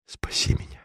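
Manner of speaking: "Спаси меня" breathed as a whisper; the count whispers "Спаси меня" to the bronze Virgin; whispered plea. A narrator's reading. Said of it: "жалобно простонал он".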